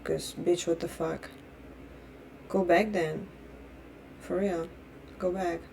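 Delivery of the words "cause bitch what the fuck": ordinary talk, nothing marked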